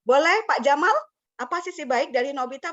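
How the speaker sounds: usual speaking voice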